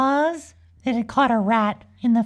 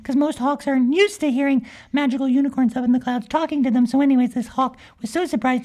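ordinary delivery

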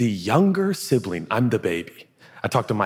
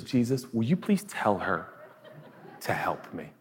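tight passive-aggressive plea, trailing softer; 2 semitones lower, 7 dB quieter than usual. Acted. Martha asks Jesus with her sister right there, passive aggressive. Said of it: "this is so, um, passive aggressive".

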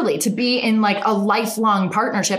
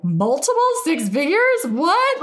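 higher, incredulous retold gasp of disbelief; 8 semitones higher than usual. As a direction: act stunned by the number. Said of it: "And I was like".

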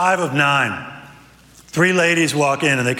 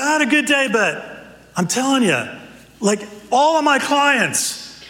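a much higher, hearty guide's greeting; upbeat adventure guide; 9 semitones higher than usual. Acted. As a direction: greet your buddies like an eager guide.

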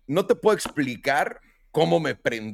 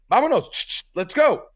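higher, louder shoo with hissing ch; 10 semitones higher, 6 dB louder than usual. Retold or imitated. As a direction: scare the animal away. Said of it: "Le hago".

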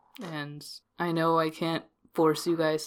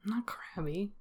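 whispering; 3 semitones higher, 11 dB quieter than usual. Acted. Character denies being crabby.

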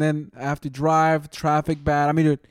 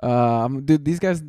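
ordinary talk, nothing marked

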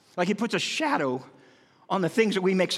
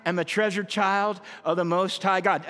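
slow repeated recital; 3 semitones higher than usual. Shown as a demonstration. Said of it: "Would you say it again?".

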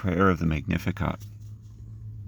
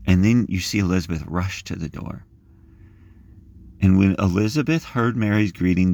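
normal talking voice

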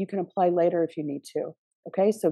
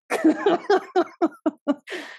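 light laugh; 9 semitones higher than usual. Real, not acted. Laughing after the story.

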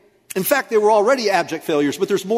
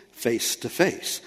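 softer and slower, intimate; soft face to face; 6 semitones lower, 6 dB quieter, much slower than usual. Shown as a demonstration. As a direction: lean in and say it gently, slowly. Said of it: "Women talk like this".

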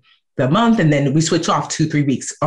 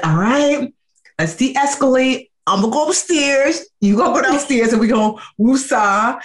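mock calm-down; 8 semitones higher than usual. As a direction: lightly act the peacemaker. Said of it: "'cause it means".

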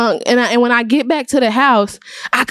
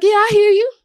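lightly voiced pastor, higher and easy; patient pastor; 8 semitones higher than usual. Pastor answering the angry teen, lightly voiced and easygoing. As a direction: humor him gently, letting it slide.

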